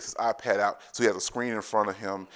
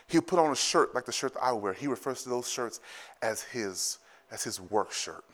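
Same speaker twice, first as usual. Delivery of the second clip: usual speaking voice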